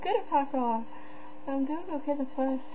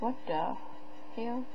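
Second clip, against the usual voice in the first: usual speaking voice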